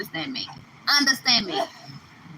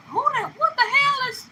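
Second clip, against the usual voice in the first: shrill mock mama, much higher, half-laughing; shocked mama. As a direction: play a mama stunned by her changed child. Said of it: "Your own mama gonna be like".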